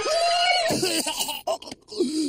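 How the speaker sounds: whooping battle cry; warrior battle cry. Pretend. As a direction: let out a mighty war whoop. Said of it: "I have a mighty warrior battle cry".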